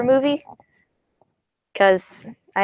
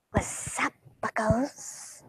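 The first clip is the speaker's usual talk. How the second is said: hushed, higher-pitched imitated greeting; catchphrase impression; 3 semitones higher, 10 dB quieter, much slower than usual. Giving his usual greeting to the buckos, soft imitation. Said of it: "Usually he would say something".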